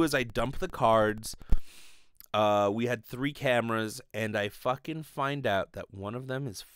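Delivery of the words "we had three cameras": usual speaking voice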